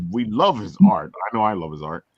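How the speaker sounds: ordinary talk, nothing marked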